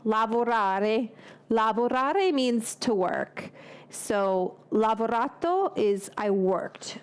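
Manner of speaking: Lavorare said slowly in Italian, each syllable clear; slow careful Italian Lavorare; Italian pronunciation. Shown as a demonstration. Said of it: "it's the past participle for worked".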